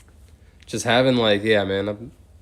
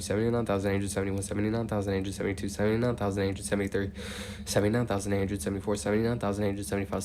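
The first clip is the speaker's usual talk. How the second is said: steady, rhythmic number chant; monotone counting; 9 dB quieter, faster than usual. Real, not acted. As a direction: count on evenly in an unchanging tone, softer than chat. Counts aloud toward eighty thousand.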